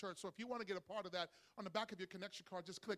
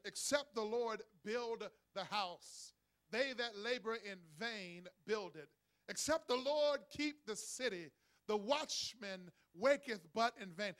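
solemn scripture reading; 3 dB louder, slower than usual. Real, not acted. Reads verse in solemn style.

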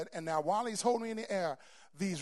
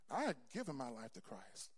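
ordinary talk, nothing marked

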